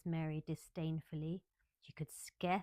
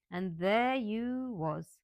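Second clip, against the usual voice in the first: disappointed; 3 semitones higher, 6 dB louder, much slower than usual. Acted.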